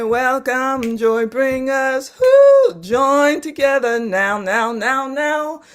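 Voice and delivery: rousing proclamation. Shown as a demonstration. Teacher proclaims the poem, building to a rousing climax. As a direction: build it up to a rallying call. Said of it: "I say".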